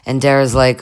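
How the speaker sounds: usual speaking voice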